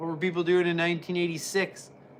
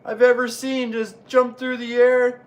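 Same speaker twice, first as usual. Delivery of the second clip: excited commentary; 5 semitones higher, 6 dB louder than usual. Acted.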